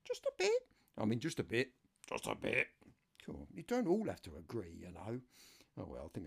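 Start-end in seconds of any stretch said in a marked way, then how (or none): 0.1-0.6 high falsetto answer; mock reply voice. Listeners answering the host, high falsetto. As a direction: answer himself in a high put-on voice. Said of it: "Mad, aren't I?".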